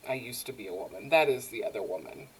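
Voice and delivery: low, hushed retold admission; subdued, low-voiced reveal; quiet confession. Retold confession on park bench.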